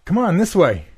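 high urgent yell. A narrator's reading. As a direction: shout to the others to run. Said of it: "I yelled".

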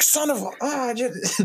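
high, strained mock curse, breaking off; pained cursing. Man who jerked his knee into the table, high pained cursing. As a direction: play a frustrated, swallowed swear.

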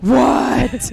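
loud, high stunned yelp; stunned what. Retold or imitated. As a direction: blurt it out in disbelief. Retold shock at free tickets.

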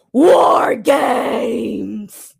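booming announcer shout, drawn out; War Games impression. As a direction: bellow it like a wrestling ring announcer. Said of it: "he wanted me to do a War Games impression".

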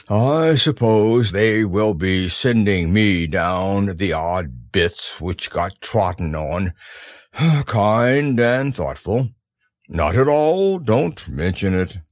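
slow, low gloomy donkey; Eeyore voice. Acted. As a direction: sigh it out, self-pitying. Eeyore speaking.

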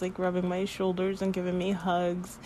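ordinary talk

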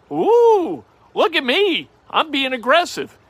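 high, hammed-up ooh of self-congratulation; mock excited. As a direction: show off a tiny bet like it's daring. Mock self-hype over casino bet.

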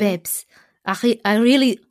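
badly attempted French accent; halting stab at a French accent. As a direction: put on a shaky-footed French accent. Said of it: "I can do a French accent".

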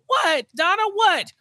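high, exasperated what; mock exasperated Harvey. Harvey answering Donna, high and exasperated. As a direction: snap back in a high mock voice.

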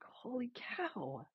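slow breathless whisper; awed whisper. Retold or imitated. Reacting to God's call to Mexico, a slow awed whisper. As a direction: barely breathe the words in awe.

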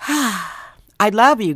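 a real sigh, then a very slow, choked-up "I love you"; tearful thanks to the listeners; misty, emotional. Real, not acted. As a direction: let the emotion catch you as you thank them. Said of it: "Misty moment".

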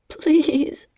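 high loud tearful please; blubbering plea. A narrator's reading. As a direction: beg through tears. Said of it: "I said, blubbering".

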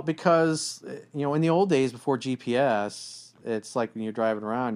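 usual speaking voice

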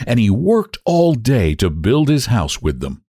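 ordinary delivery

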